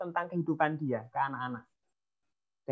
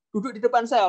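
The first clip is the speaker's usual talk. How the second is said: higher, bossy command to sit; sit the kid down, stern; ordering a child; 6 semitones higher, 4 dB louder than usual. Retold or imitated. Parent ordering child to sit for a lecture, bossy. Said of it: "juga nggak bisa seperti ini".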